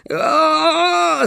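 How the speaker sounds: long high furious howl; enraged wail. Acted. Alkhazab howls at Trix as he grabs his sword, enraged. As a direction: howl with rage before charging. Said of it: "завопил Алхазаб".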